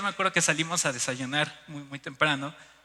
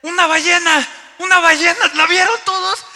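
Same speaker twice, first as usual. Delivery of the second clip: high, loud cry of discovery; excited shouting; 11 semitones higher, 12 dB louder than usual. Retold or imitated. Tourist alerting the whole lobby to a whale, excited shouting. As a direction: shout it out to everyone around. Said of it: "empecé a gritar".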